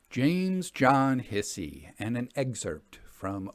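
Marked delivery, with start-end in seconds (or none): none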